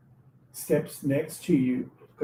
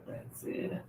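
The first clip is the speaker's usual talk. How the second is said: unvoiced, hushed; whispered realization; 16 dB quieter than usual. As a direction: breathe it as a quiet realization. Quiet realization.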